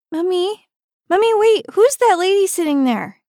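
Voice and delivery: put-on high, loud little-kid voice; small child. A narrator's reading. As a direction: call out like a child pestering mummy. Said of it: "asked a small child".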